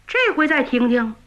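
ordinary delivery

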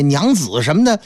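usual speaking voice

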